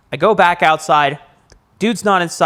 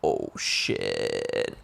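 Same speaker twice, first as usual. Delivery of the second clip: breathless whisper; whispered dread; 11 dB quieter than usual. Retold or imitated. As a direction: whisper it in shock. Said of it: "And they're like".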